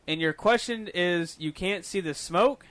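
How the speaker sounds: drawn out, slow, disbelieving; slow mock-patient question; slow incredulous question. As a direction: spell it out slowly like he's dim. Retold incredulous question to friend.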